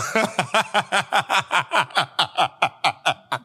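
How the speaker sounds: sustained, pulsing chuckle; long laugh. Real, not acted. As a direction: crack up mid-announcement and keep laughing. Cracks up at own plug.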